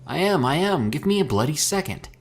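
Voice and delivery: high, heated snap back; lashing out. A narrator's reading. Mika snapping back at Herschel while working dials. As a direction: fire it back under pressure. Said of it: "Mika lashed out".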